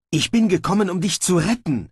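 high, urgent outburst; blurted out. A narrator's reading. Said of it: "entfuhr es Snibrill".

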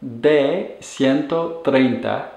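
slow, careful Spanish model sentence; slow model phrase. Shown as a demonstration. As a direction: spell out each word slowly for learners. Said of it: "this is the preterite of the verb ser".

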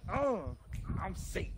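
usual speaking voice

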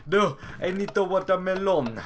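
high, very loud outburst; silly desperate yell. Pretend. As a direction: yell it as a mock emergency. Sudden silly outburst in game.

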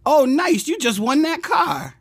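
happy for your friend; upbeat congratulation. Retold or imitated. Friend congratulating a negative person, upbeat. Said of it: "Overly negative people".

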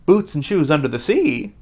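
plain reading voice

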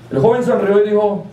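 usual speaking voice

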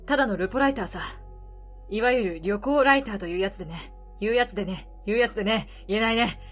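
put-on gruff weary older man, low. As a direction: speak low like a tired drifter. Retakes reporter line.

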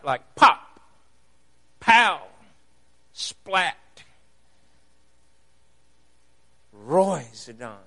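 "pop", "pow", "splat", "roizodon" spoken as sound effects; sound-word demo. Shown as a demonstration. Preacher showing onomatopoeia to the congregation, playful sound words. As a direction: make each word sound like its noise. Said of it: "A word that sounds like the reality".

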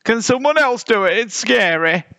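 high, scared bear whine; high-pitched timid pleading; whining. A narrator's reading. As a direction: whine to get out of it. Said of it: "whined the one with the biggest".

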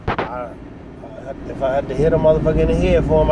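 ordinary talk

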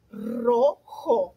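"rojo" in two slow, separate syllables, ro, jo; split into syllables. Shown as a demonstration. Teacher repeating for pupils, "rojo" split into syllables. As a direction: say "rojo" slowly with a gap before "jo".

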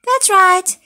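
high, loud burst of praise; excited praise. Real, not acted. Teacher praising the children's answer, high and loud. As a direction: cheer the right answer.

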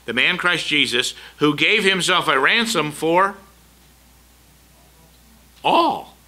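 rising into a loud preaching call, the last word soaring high. Real, not acted. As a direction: thunder the verse, lifting all at the top. Preacher quoting scripture with force.

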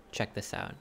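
hushed aside; whispering. Real, not acted. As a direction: breathe it out quietly. Unvoiced aside.

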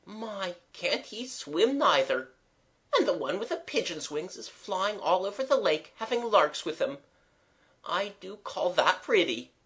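high-pitched, spellbound running commentary; rapt voice. A narrator's reading. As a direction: marvel at each sight, enraptured. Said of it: "said Eliza in a rapt voice".

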